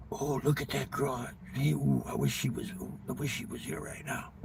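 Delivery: low, hushed leering voice; breathy, furtive mock ogling; creepy lurker. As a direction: mutter softly like a pervert watching. Voices creepy guys in tents.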